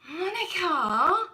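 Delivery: high, slow, drawn-out outrage; mock scandalised. Real, not acted. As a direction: scold a friend in playful shock. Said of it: "Oh, I feel bad".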